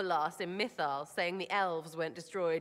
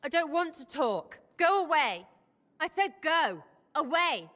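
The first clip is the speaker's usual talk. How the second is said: higher, louder upset go away; upset outburst; 6 semitones higher, 3 dB louder than usual. Real, not acted. Sera, shaken by a dream, shouts at her lover to go away. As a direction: push them off, near tears.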